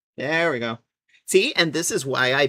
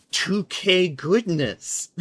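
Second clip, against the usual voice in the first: savoured; 5 semitones higher, 6 dB louder, much slower than usual. Real, not acted. Savouring the high resolution.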